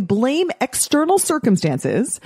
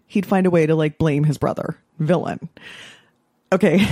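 ordinary delivery

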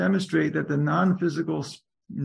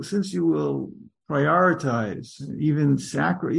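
normal talking voice

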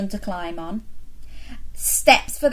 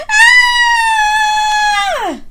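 very high, loud scream; 10 dB louder than usual. Acted. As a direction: scream like scared kids. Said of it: "the other children screamed".

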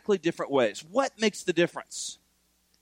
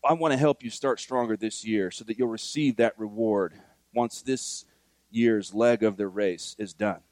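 ordinary talk

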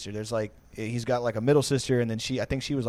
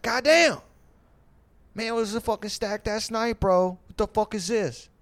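outraged bartender; 10 semitones higher than usual. Retold or imitated. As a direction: play the bartender shocked at his tiny tip, pitched way up. Bartender complaining about his tip.

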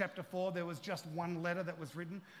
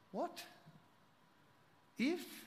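incredulous; 10 semitones higher than usual. Acted. Incredulous reaction to tempter.

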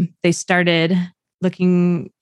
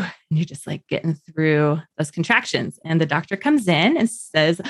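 normal talking voice

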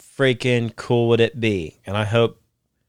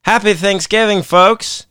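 excited greeting; 9 semitones higher, 5 dB louder than usual. Real, not acted. Holiday greeting to listeners.

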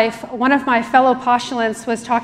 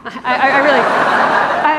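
breaking into laughter mid-sentence; can't get the words out for laughing; sputtering through real laughter; 3 semitones higher, 4 dB louder than usual. Real, not acted. Laughing at her own blunder.

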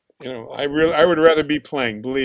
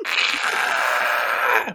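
harsh airy scream; demonstrated scream; 5 semitones lower than usual. Retold or imitated. Drummer screaming on the producer's request, demonstrated scream. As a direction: let out a retold studio scream. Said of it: "I want you to scream real loud".

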